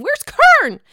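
loud, high-pitched mock search; high calling out. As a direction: call out KAREN high and loud. Mock search, escalated second take.

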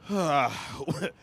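heavy, falling-pitch exhale; exaggerated breathy sigh; insistent sigh. Shown as a demonstration. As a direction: sigh pointedly for attention. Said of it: "you have to keep sighing".

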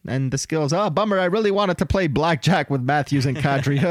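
high mock-whiny voice, rising on bummer; mocking whiny excuse. Host mocks Ovechkin skipping the All-Star game, high whiny voice. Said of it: "He's like".

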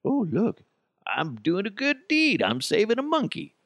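high mocking airhead voice; mock selfie lady. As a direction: play her smug and silly. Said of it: "Be like".

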